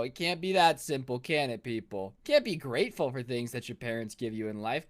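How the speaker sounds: ordinary talk, nothing marked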